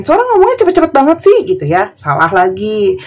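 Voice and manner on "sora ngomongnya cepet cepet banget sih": high, fast whiny complaint; mocking complainer. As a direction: rattle it off like a nagging viewer. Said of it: "kalau aku ngomongnya kecepetan dikomplain". (meniru protes penonton, tinggi dan cepat)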